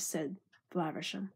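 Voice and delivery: usual speaking voice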